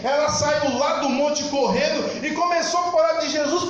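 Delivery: high-pitched, driving sermon delivery; fervent preaching. Real, not acted. Preacher telling of the woman who ran to tell everyone, fervent preaching. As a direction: preach it in one excited, rising rush.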